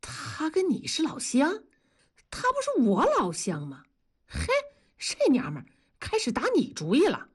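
high-pitched, incredulous retort; indignant disbelief. Acted. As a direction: protest as if you've been cheated. Indignant disbelief after the teasing.

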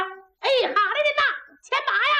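high, ringing call to the back; waiter calling out. Acted. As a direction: sing it out like a teahouse waiter. Waiter shouts order to stable.

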